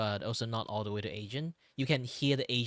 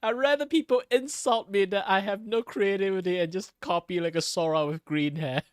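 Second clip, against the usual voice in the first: louder and higher, theatrical indignation; mock rant; 8 semitones higher, 7 dB louder than usual. Streamer ranting about being called a copy, hammed-up huff.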